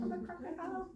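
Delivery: high silly crocodile voice. Acted. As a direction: ham up a playful crocodile. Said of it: "that wasn't a very good crocodile voice".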